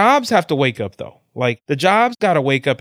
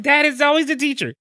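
higher-pitched imitation of his kid; complaining son. Son blaming the teacher to his dad, high-pitched complaint. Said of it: "He's like".

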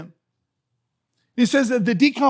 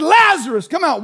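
raised, high call; holler; 5 semitones higher, 6 dB louder than usual. Acted. Jesus hollering for dead Lazarus, high and loud. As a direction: holler to someone who can't hear well. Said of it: "He had to holler".

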